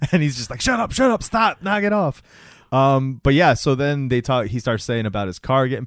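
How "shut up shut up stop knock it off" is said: high, rapid mock-panicked pleading; retold spooked protest. Retelling the man getting creeped out, mock-panicked protest. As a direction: imitate someone creeped out, pitch jumping way up.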